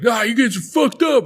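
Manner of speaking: high-pitched mimicked disgust; mock appalled outburst. Outsiders condemning the villagers' ritual, mock appalled outburst. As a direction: imitate a freaked-out onlooker.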